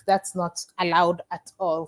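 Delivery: ordinary delivery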